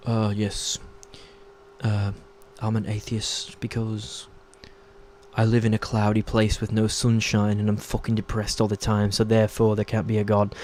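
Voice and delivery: put-on lampoon a depressed British atheist in a low, quiet accent. Mocking gloomy British atheists who blame cloudy skies, in a put-on British accent. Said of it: "that's a good accent".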